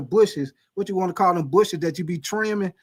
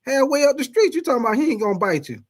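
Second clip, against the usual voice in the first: indignant high retort; 8 semitones higher than usual. Retold or imitated. Answering the sister after the chase, high indignant disbelief. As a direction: fire back in disbelief. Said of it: "I'm like".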